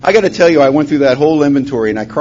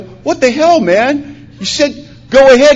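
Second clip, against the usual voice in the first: high-pitched indignant mock yell; retold yelling; 11 semitones higher, 3 dB louder, slower than usual. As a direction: yell it back in disbelief, acting it out. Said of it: "both look at each other and start yelling".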